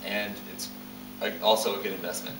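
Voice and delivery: ordinary delivery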